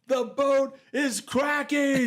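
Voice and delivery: high, loud, drawn-out imitated holler; alarmed yelling. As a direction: yell it slowly from the bow in mock panic. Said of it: "Santella is yelling up in the bow".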